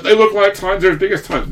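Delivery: high and loud, insisting eagerly; excited fan. Retold or imitated. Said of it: "was like".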